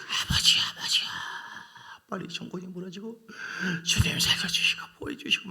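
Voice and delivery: whispered, breathless secret prayer; hushed whisper prayer. Retold or imitated. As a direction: pray in a whisper under the blanket. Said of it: "숨죽이면서".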